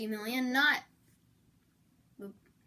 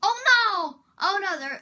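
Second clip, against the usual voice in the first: higher, louder oh no; startled at spiders; hammed-up fright; mock alarm; 8 semitones higher, 11 dB louder than usual.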